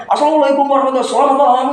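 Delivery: mock a fake, showy radio voice; overdone announcer greeting. Shown as a demonstration. Trainer mocking the showy opening announcers should avoid, laughing and high. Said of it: "Ini enggak usah, dibikin-bikin".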